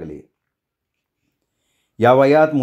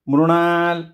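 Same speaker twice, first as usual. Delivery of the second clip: higher-pitched call of a name; a narrated summons, raised but not shouted; 4 semitones higher, much slower than usual. A narrator's reading. Rekha calling to an unresponsive Mrunal, calling out. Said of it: "रेखानं आवाज दिला".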